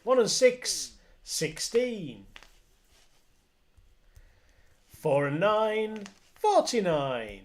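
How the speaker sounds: loud, high bingo number call; bingo caller. Acted. Bingo calling on stream.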